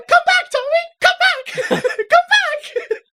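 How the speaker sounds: very high, loud falsetto wail; imitating a frantic mom with laughter between cries; mock calling mother. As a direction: call after a fleeing child, hammed-up. Acts out mother chasing son.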